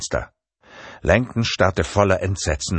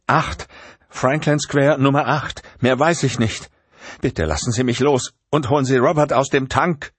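pleading Catherine; 8 semitones higher than usual. A narrator's reading. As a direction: beg to be let go.

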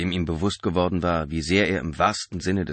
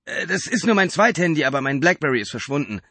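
higher, louder flustered Mark; hasty lie; 9 semitones higher, 6 dB louder than usual. A narrator's reading. Mark lying hastily to the driver, higher and louder. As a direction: blurt the excuse quickly. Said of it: "log er hastig".

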